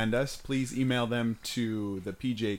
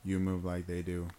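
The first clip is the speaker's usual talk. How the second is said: monotone robot voice, each word even; 7 semitones lower, 8 dB quieter than usual. Pretend. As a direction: say it like a robot, flat and mechanical. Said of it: "like, robot, though".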